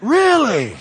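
loud, high incredulous really. Shown as a demonstration. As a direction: throw it out with full disbelief. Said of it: "y'all gotta do better than that".

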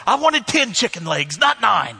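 loud, high comic complaint; hammed-up shouting diner; mock outrage. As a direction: make a mock-furious fuss over a tiny order. Acts himself as complaining diner.

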